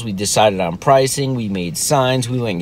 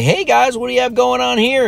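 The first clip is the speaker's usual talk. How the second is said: much higher put-on neighbor greeting; friendly neighbor; 13 semitones higher than usual. Neighbors come upon kid's stand, high-pitched imitated greeting. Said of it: "the first person who comes up happens to be some of our neighbors".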